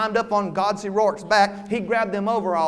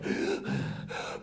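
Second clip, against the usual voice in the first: winded gasp; 9 dB quieter than usual. Acted. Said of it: "he stopped long enough to say".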